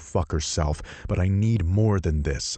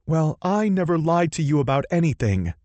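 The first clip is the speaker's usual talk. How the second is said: higher, louder woman's yell; yelling heroine; 11 semitones higher, 5 dB louder than usual. Acted. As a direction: yell it in indignation. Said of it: "she yells".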